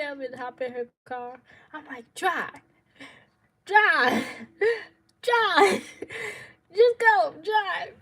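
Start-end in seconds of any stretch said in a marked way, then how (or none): 2.1-7.9 high, very loud urgent commands; frantic retold shout. Fleeing woman ordering her sister to drive off, frantic shouting. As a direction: yell it repeatedly, desperate to get away.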